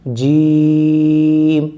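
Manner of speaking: جیم stretched very long on one breath; drawn-out letter name. Shown as a demonstration. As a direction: draw جیم out as far as your breath goes. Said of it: "کھینچ کر پڑھنا ہے".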